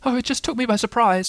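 high-pitched, nervous little voice; small anxious animal voice. A narrator's reading. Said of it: "said Piglet nervously".

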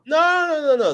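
high, rapid-fire string of no's; emphatic objection. Real, not acted. Host objecting that nobody will buy them, emphatic.

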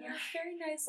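high, soft mimic of her friend; soft-voice imitation. S1 mimics S0's soft voice, softly and high. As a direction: tease her soft little voice. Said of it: "yours is, like, soft and".

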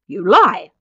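loud, stammering outburst; angry accusation. A narrator's reading. Father denying his aged son, loud and accusing. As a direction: hurl the accusation loudly.

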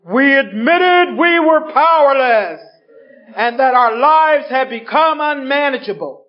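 slow booming solemn recital of the step; the voice I read it in. Retold or imitated. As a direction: intone it like a grave announcement. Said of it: "that was the voice".

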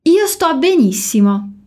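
slow, careful Italian for learners to repeat; slow model phrase. Shown as a demonstration. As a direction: say it slowly so the class can copy. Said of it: "Allora dillo dopo di me".

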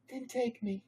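Hermione. A narrator's reading. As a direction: pitch it right up for the girl.

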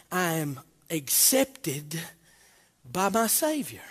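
slow and soft; hushed. Acted. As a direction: let it land gently.

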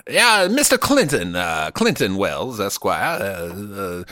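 a hint of a stuffy Southern gentleman stammering politely; Southern gentleman accent. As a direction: play a fussy old Southern gentleman, hesitant and formal. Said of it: "He was a Southern".

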